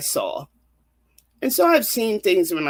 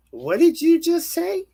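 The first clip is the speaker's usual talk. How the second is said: shocked disbelief; 13 semitones higher, 4 dB louder than usual. Retold or imitated. Begging mother to repeat.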